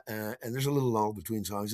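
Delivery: ordinary delivery, nothing marked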